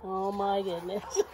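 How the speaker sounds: ordinary delivery, nothing marked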